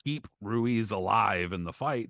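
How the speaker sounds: ordinary delivery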